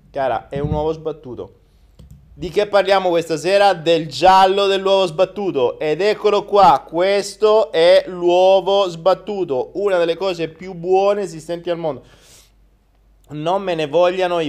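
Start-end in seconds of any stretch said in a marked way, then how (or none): 2.3-12.0 louder, higher showman announcement; excited announcement. Real, not acted. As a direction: proclaim the topic proudly. Announcing the evening's topic.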